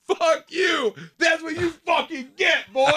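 high, loud angry shout; furious sideline yell, acted out. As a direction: yell it like a coach losing it on the field. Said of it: "starts yelling at him".

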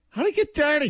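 high puzzled squeak. Retold or imitated. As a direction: squeak it out in disbelief. Acted puzzled quote.

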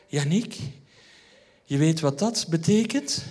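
slow, seductive mock whisper of the wife; wife playfully imitated in a slow seductive voice; sensual voice. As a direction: lay on the seduction, drawn out. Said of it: "met de meest sensuele stem".

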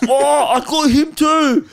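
very high, loud, drawn-out holler; rowdy ape-like dude. Retold or imitated. Mimicking a dude boasting to his mates, rowdy ape-like yell. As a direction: crow it like an excited zoo monkey. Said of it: "like watching monkeys at the zoo".

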